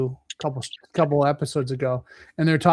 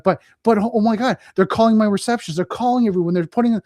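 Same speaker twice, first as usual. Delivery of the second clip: higher, rushed, frightened pleading; panicked customer; 6 semitones higher, 3 dB louder than usual. Retold or imitated. Incident responder imitating a frightened client under extortion, panicky. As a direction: sound like a client losing control.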